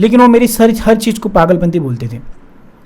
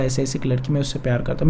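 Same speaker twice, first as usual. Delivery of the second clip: much softer, a hushed admission to mum; quiet confession; 5 semitones lower, 8 dB quieter than usual. Retold or imitated. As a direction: say it softly, almost ashamed. Confessing love to mother, tearful. (धीमी आवाज़ में झिझकते हुए कबूल करना)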